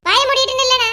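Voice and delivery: an irritated elder snapping at the pair; scolding. Acted. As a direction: shut them up before the quarrel starts. Mother-in-law scolds the bickering pair.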